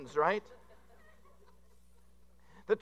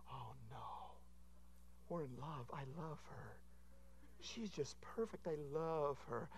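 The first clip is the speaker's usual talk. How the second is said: much softer, hushed lovestruck groom; dreamy in-love whisper; 3 semitones lower, 19 dB quieter, slower than usual. Retold or imitated. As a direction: breathe it like a starry-eyed fiancé. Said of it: "I always ask them".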